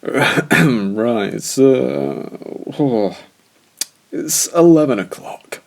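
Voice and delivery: put-on loud, high, slurring drunk; drunken slur. A narrator's reading. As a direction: slur it like a tipsy old teacher. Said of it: "Keith drunkenly slurred".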